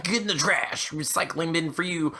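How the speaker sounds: lightly hammed-up good riddance; mock dismissal. Acting out deleting the intro in a panic.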